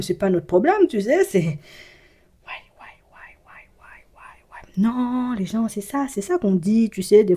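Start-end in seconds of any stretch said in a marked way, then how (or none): 2.4-4.7 whisper. Real, not acted. Whispered interjection between sections.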